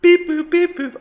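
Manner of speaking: high, loud beep boop noises; robot beeping. Pretend. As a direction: beep like a little machine. Mocking a glowing character.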